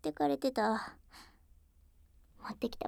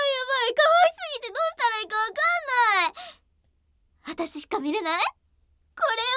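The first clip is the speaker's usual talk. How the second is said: much higher, loud, rushed gushing; overwhelmed squealing; 14 semitones higher, 8 dB louder, faster than usual. Acted. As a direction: squeal over how cute she is. Overwhelmed by cuteness.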